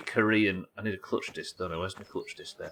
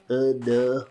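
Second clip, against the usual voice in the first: put-on Hull accent; 3 semitones higher than usual. Streamer imitating an accent tutorial for viewers, broad Hull accent. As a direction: snap straight into exaggerated Hull vowels. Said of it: "how to do the Hull accent".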